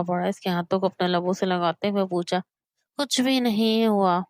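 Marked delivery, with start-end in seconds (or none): none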